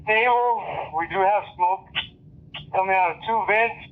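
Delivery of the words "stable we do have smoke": ordinary talk, nothing marked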